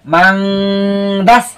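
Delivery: মাংদাস with a very long nasal "ng" hum before দাস; hidden n, ghunnah. Shown as a demonstration. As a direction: recite মাংদাস slowly, holding the hidden n. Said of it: "গুণনা করে পড়তে হবে".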